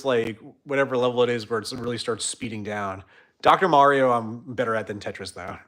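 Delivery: normal talking voice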